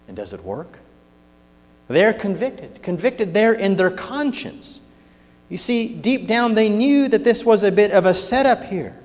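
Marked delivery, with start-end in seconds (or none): 1.9-9.0 rising, louder sermon build; full-voiced pulpit warning; fiery preaching. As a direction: preach it up to a strong finish.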